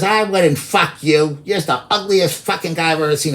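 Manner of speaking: nasty woman. Retold or imitated. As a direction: drag out the insult like her. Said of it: "she got all real nasty".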